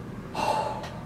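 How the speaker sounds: soft, breathy sigh. Real, not acted. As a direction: let out a quiet breath. Distracted by geese outside.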